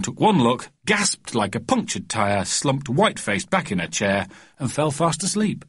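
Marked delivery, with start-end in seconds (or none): none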